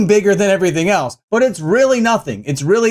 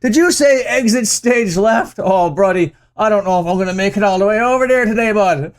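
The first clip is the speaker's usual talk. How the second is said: higher-pitched mock Irish complaining; put-on Irish accent; 4 semitones higher than usual. Host imagines injured rapper hobbling around, hammy Irish accent. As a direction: play the injured rocker as a woeful Irishman. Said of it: "I don't know why I made him Irish".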